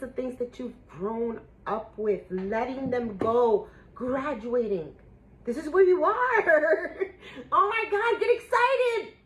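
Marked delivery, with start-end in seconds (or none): 5.4-9.1 laughing and near tears, higher and louder; overjoyed, laughing through tears; excited. Real, not acted. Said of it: "I'm so freaking excited".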